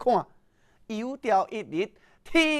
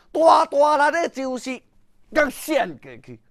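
plain reading voice

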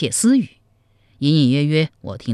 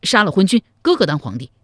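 loud, higher angry shout; furious outburst; 4 semitones higher, 5 dB louder, faster than usual. Acted. Hot-headed brother urging rebellion, angry shout. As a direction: blurt out treason in a rage. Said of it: "怒气冲冲地说".

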